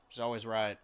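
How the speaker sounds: ordinary delivery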